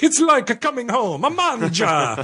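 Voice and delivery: high, drawn-out Italian-American commercial voice. Retold or imitated. Italian-American ad voice mocking an Olive Garden commercial. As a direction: stretch it out like a cheesy Italian restaurant ad. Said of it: "is it ever offensive to you that they're like".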